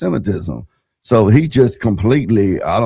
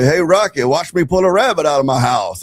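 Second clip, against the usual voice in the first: higher cartoon moose voice, lightly done; Bullwinkle voice; 6 semitones higher than usual. Retelling a kids' cartoon to the hosts, higher mock Bullwinkle voice. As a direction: toss off a playful cartoon Bullwinkle line. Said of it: "Bullwinkle would say".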